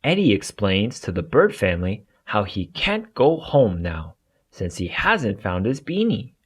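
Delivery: ordinary delivery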